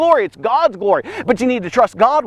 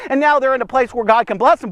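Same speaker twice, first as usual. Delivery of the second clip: ordinary delivery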